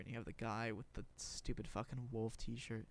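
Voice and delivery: usual speaking voice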